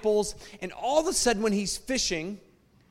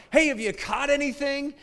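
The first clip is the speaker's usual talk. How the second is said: higher, raised call across water; calling from shore; 8 semitones higher than usual. Acted. Jesus on the shore calling out to the fishermen. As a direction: call out to the boat. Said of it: "on the sidelines yelling".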